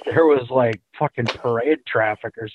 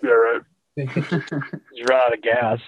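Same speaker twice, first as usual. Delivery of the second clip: ordinary delivery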